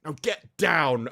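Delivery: loud, high, slow mock dominatrix command; laughing through a mock command. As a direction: ham up a domineering boss. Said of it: "goes completely, like, dominatrix".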